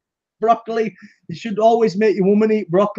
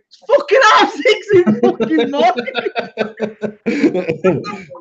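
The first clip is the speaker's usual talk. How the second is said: incredulous laughing shout; laughing exasperation; 8 semitones higher than usual. Real, not acted. Exasperated laughing rant about dad.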